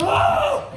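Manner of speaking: sudden high, loud scream; horrified waking scream. Acted. Acts waking up in horror.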